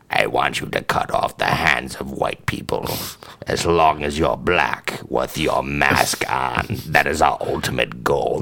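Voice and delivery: deep, hushed sinister emperor voice; low quiet villain summoning his apprentice; Emperor Palpatine impression. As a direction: growl it low like a scheming Sith lord. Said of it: "Emperor Palpatine's like".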